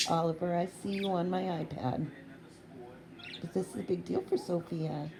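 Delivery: usual speaking voice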